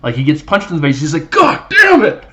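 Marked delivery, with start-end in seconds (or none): 1.3-2.2 sudden high, loud yell of "God damn it!"; explosive cry of pain and anger; pained shout. Retold or imitated. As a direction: bellow it as the punch lands. Retold reaction to being punched.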